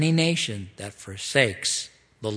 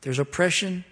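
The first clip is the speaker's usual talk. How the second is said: higher, indignant scolding voice; God rebuking the leaders; 6 semitones higher than usual. Pretend. A touch of God rebuking the rulers, higher. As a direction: call the elders out. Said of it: "He goes".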